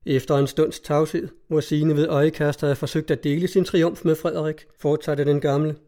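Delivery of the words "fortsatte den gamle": ordinary delivery, nothing marked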